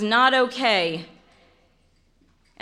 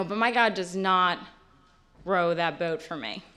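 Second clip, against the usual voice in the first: ordinary talk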